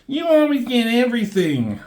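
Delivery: high-pitched, put-on whine; hammed-up high-voiced griping; mock complaining. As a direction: complain like a jealous kid, exaggerated. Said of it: "Havoc is complaining".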